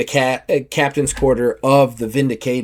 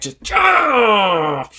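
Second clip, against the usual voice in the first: battle cry; 8 semitones higher than usual. Acted. Killing blow, battle cry.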